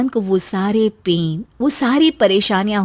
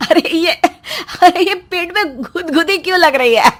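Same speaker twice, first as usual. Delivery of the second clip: higher, louder, tickled and puzzled; tickled giggly voice; 6 semitones higher, 5 dB louder than usual. Acted. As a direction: laugh through the tickle. Husband feels tickling. (गुदगुदी से हँसता हुआ)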